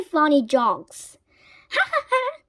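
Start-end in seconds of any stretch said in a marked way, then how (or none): 1.7-2.4 high cackling ha ha ha; high witch cackle. A narrator's reading. Winnie laughing at funny jokes, high cackle. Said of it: "laughed Winnie".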